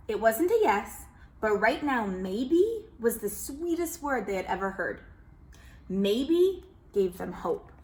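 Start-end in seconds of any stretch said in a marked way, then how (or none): none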